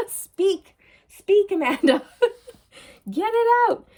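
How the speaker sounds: high, loud, laughing at herself; tongue-tied and cracking up; laughing self-coaxing. Real, not acted. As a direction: coax your own words out through giggles.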